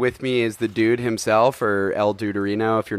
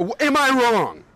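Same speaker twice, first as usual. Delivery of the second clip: indignant Walter; 11 semitones higher than usual. Acted. Walter cuts Smokey off.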